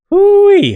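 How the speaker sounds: high, loud shocked whoop. Real, not acted. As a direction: cry out at the stench. Reacting to fart smell.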